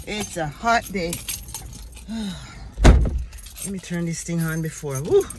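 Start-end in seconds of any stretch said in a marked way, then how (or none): 1.2-3.6 heavy sigh. Real, not acted. Overheated in the mask. 5.0-5.3 loud relieved whew. Real, not acted. Overheated in the mask.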